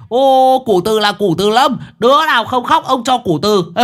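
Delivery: very high, loud, manic shout; madman's yell. A narrator's reading. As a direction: holler after the girl like a lunatic. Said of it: "nó hét".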